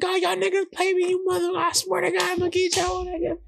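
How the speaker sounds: high-pitched furious threat, imitated; high mock cuss-out, fading at the end; mock angry cussing. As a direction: shriek the threat while running away. John cussing while running.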